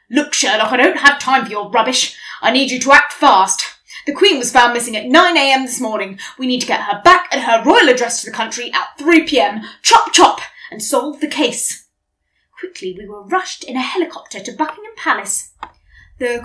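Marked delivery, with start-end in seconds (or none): 0.1-11.9 loud, fast bossy rant; impatient brother. Acted. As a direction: hurry him up, no patience. Impatient brother orders Sherlock.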